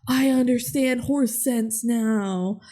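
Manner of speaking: slowed-down dreamy line; mock slow-motion epiphany. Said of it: "slow motion ... being like".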